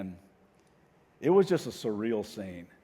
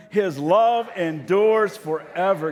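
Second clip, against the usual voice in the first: loud, high, very slow full-voice refrain; shouted chorus; 7 semitones higher, 10 dB louder, much slower than usual. Shown as a demonstration. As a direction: shout it out with the whole congregation. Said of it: "kinda shout out the chorus".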